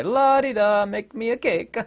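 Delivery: breezy and clueless, a cake order while tanks roll; oblivious baker. Retold or imitated. Oblivious French baker, carefree and high.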